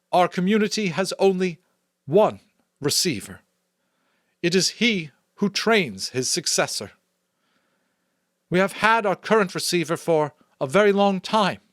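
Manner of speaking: formal, elevated public address; Chief Elder addressing the crowd. A narrator's reading. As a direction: speak from the stage like a leader addressing her people.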